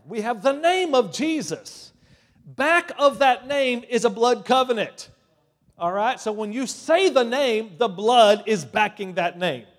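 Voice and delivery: loud, high proclamation; emphatic preaching shout. Real, not acted. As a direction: drive it home at full preaching force. Preacher proclaims the name of Jesus.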